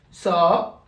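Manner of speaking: "sa" with a long held aa; long vowel. Shown as a demonstration. Teacher showing sin followed by alif, "sa" held long. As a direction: draw out the vowel of "sa". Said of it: "suivie d'une voyelle longue".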